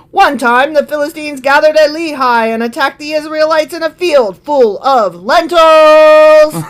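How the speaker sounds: loud announcer, slowing at the end. Acted. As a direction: drag out the last words grandly. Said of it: "the announcer voice".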